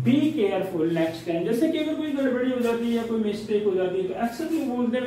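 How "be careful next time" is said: "be careful next time" spoken slowly and evenly; slow teaching demo. Shown as a demonstration. Teacher slowly models the full phrase for students. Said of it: "इसको बोला जाएगा".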